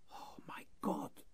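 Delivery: breathless, hushed gasp of shock; the words catch in her throat; shocked whisper. Acted. Woman recognises her brother.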